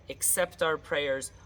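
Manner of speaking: ordinary delivery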